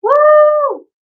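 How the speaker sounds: a high, loud celebratory whoop; a joyful shout after the last note; excited whoop. Pretend. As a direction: cap off the song with a big whoop. Cheer at end of song.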